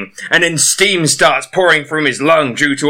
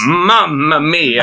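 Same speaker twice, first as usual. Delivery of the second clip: Italian exclamation; much slower than usual. Pretend.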